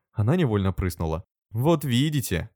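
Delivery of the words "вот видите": higher, lecturing tone; preachy told-you-so; didactic. A narrator's reading. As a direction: say it like an instructor. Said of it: "проговорила наставительно".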